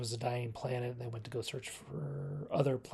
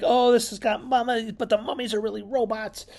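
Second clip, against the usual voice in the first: mock excited viewer; 9 semitones higher, 7 dB louder than usual. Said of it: "so you're like".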